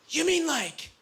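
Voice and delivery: high, wide-eyed innocent question; most innocent voice. Retold or imitated. Friend asks the comic innocently, in a high innocent voice. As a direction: ask it like a sweet naive child. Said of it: "in the most innocent voice I've ever heard".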